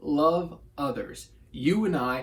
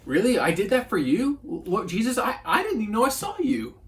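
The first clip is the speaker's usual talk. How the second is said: stunned disbelief; 7 semitones higher than usual. Retold or imitated. A believer, hearing Jesus thank them, in mock disbelief. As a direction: act floored, as if you never knew. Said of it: "You're like".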